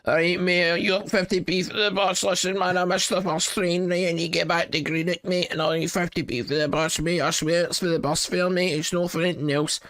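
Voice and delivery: hammed-up west of Scotland beggar asking for fifty p; west of Scotland accent. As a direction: put on a thick west of Scotland accent and cadge bus fare. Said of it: "I do my west of Scotland voice".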